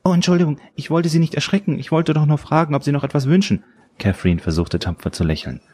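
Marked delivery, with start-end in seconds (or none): 0.0-3.6 high-pitched, apologetic server voice; waitress. A narrator's reading. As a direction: apologise in a much higher voice. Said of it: "fragte die Bedienung". (hohe, entschuldigende Kellnerinnenstimme)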